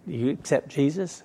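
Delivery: half-laughing mock of a street evangelist; syrupy evangelist voice. Preacher mocking what somebody comes up and says, softly. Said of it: "Somebody come up and say".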